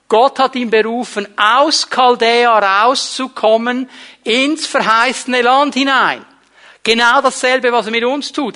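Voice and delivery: loud, high, driving sermon delivery; fervent preacher lifting the congregation; preaching at full force. Acted. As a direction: preach it out, slowing on the call to leave.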